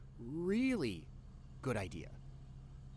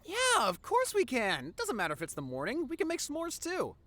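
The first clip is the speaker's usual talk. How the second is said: eager yes; 12 semitones higher, 6 dB louder than usual. Real, not acted. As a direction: burst out happily agreeing to the bonfire.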